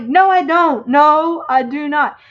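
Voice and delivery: retold indignant denial. Denying a Southern accent to her friend, retold indignant protest. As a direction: deny it in playful outrage. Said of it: "I'm like".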